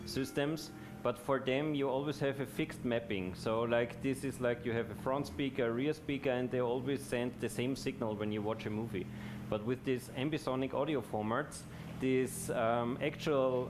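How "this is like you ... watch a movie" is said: ordinary delivery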